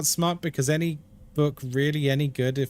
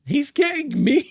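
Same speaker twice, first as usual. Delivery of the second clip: much higher and louder, playfully scandalised; mock shocked squeal; 11 semitones higher, 5 dB louder than usual. Real, not acted. Streamer squealing at Ghosty's teasing, playful mock shock. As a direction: squeal it in fake outrage.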